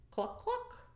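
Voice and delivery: very high cluck cluck; hen cluck. Acted. Reading to a child, a high clucking hen. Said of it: "And the hen said".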